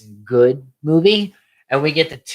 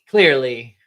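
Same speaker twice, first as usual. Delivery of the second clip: ordinary talk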